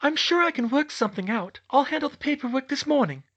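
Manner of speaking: put-on sweetness, pitched very high; forced smile. A narrator's reading. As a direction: reassure the boss through a forced smile. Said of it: "still forcing a smile".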